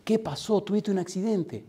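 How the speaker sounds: high-pitched worried questioning; alarmed mother. Retold or imitated. Mother asking her crying son about an accident, alarmed. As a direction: imitate a mother suddenly alarmed.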